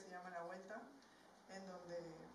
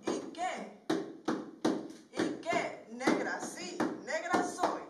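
proud spoken-word declaration, rising and punchy; emphatic, rhythmic verse to a crowd; defiant poem recitation; 5 semitones higher, 19 dB louder than usual. Acted. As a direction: throw the taunt back with pride.

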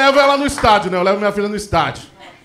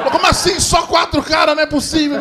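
outraged disbelief; 7 semitones higher than usual. Retold or imitated. Parents outraged at meeting.

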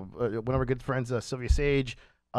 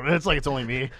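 usual speaking voice

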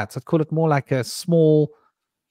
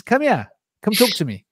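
higher-pitched retold call to kids; imitating son calling; 8 semitones higher than usual. Son to three kids across the street, calling out. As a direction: imitate a child calling others over. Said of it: "he calls the kids".

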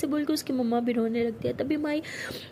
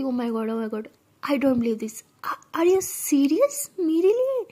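jumping with joy; 4 dB louder, much slower than usual. A narrator's reading. Girl jumps with joy when her girlfriend confesses, squealing. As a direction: burst with delighted disbelief. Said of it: "तो सना उछलते हुए कहती".

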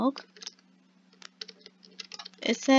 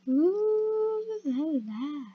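playful ooh la la; 6 semitones higher, 3 dB quieter, much slower than usual. Pretend. Admiring new boots.

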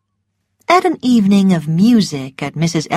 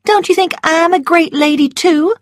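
much higher, coy and wheedling; high, pouting Southern belle; Scarlett fishing for praise; 11 semitones higher, 5 dB louder than usual. A narrator's reading. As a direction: fish for a compliment, sweetly. Scarlett voiced in story.

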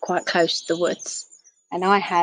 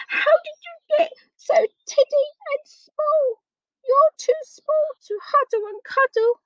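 very high, wailing character voice; sobbing bear. A narrator's reading. Storyteller voices the bear bawling over a tiny teddy. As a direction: bawl it in a squeaky, pitched-up wail. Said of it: "the sound of sobbing came nearer".

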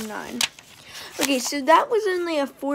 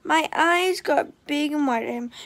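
ordinary delivery, nothing marked

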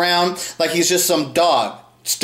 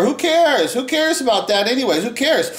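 mocking mimicry; 7 semitones higher than usual. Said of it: "Matt's F T R".

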